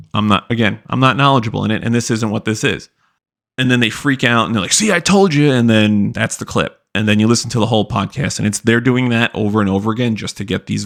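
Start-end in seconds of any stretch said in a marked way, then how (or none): 4.7-5.5 gloating. Retold or imitated. Hosts gloating after clip.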